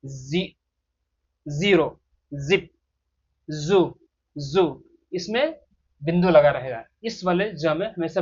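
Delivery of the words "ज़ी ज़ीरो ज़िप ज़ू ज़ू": "जीरो", "जिप", "जू" with a buzzing z; voiced z in place of j in "जीरो", "जिप", "जू"; demonstrated Z sound. Shown as a demonstration. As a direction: say "जीरो", "जिप", "जू" slowly, one at a time, with z. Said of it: "गले से vibration आने देंगे".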